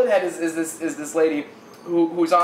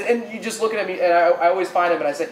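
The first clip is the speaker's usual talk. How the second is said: ordinary talk, nothing marked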